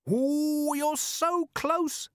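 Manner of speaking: high, long-drawn game-host encouragement; encouraging. A narrator's reading. Captain coaxing a guess from robbers, encouragingly. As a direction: stretch it out high, urging them on. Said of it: "said Carrot encouragingly".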